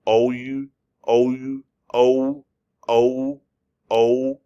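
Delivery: "O U" drawn together into a gliding "O"; O U blended into O. Retold or imitated. Teacher passing on another teacher's trick, "O U" blending into a rising O. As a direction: glide from spelled "O U" into one long "O". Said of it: "One teacher actually said".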